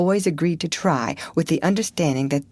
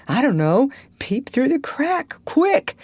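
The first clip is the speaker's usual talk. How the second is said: put-on higher, urgent scared boy; rising panic, hurry him; frightened; 9 semitones higher than usual. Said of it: "in an agony of fright".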